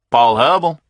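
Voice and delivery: put-on Southern accent, answering the phone with confidence; a confident Southern caller picking up the phone; confident southern man. A narrator's reading. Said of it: "A southern accent, a confident manner".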